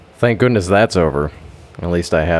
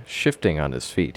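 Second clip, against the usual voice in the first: usual speaking voice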